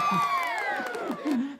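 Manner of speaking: squealing high laugh. Real, not acted. Reader laughing at the reunion, high squeal.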